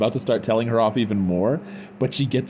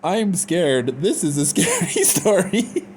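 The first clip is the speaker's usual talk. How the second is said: high, giggly mock fright; mock scared; 8 semitones higher than usual. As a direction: squeal through a laugh, pretending to be scared. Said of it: "I was like, "I'm scared".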